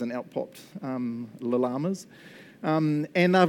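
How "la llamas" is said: "llamas" with an extra "la" in front; double L sounded. Shown as a demonstration. Pastor mocking the silly name, "llamas" said as "la-llamas". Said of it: "What's with that double L going on".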